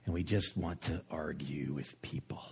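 hushed unvoiced confession; whisper. Real, not acted. Preacher admitting a fault to the congregation, whispered. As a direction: drop to a whisper, almost to yourself.

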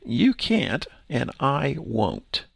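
can't pushed, then and I won't slowed right down; emphatic. Retold or imitated. Pastor voicing Pilate's meaning to the priests, slow emphatic finality. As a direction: lay it down flat and final. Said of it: "In which he's saying".

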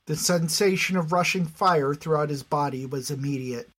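usual speaking voice

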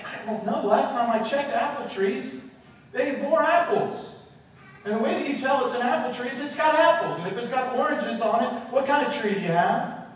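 high, loud, incredulous argument; incredulous reasoning. Acted. Preacher arguing how you tell an apple tree, incredulous.

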